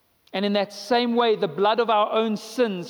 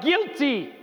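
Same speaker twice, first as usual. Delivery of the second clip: a raised, condemning call; accusing cry; 7 semitones higher than usual. Acted. Blood of sin accusing the sinner, raised and condemning. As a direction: cry out the verdict.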